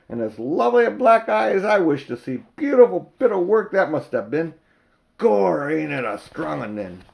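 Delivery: cheerful crowd voice marvelling at the eye; admiring cockney onlooker. Acted.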